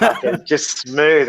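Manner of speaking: slow, laughing through the words; laughing mock awe. Host laughing at Pippen's smooth line, slow and amused. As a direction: savour it, half laughing. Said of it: "I was like".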